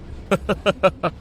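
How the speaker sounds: high, choppy laugh; mocking chuckle. Passenger reacting to the girl's retort, mocking chuckle. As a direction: laugh off the warning.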